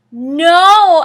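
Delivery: high, loud anguished scream. Acted. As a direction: scream it in horror. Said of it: "I screamed".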